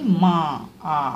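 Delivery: "मा" stretched slowly, then its long "आ" vowel alone; drawn-out vowel demo. Shown as a demonstration. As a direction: draw out "मा" so the "आ" behind the consonant is heard. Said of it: "माँ का उच्चारण जब हम कर रहे हैं".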